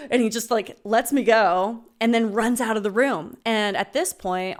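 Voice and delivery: plain reading voice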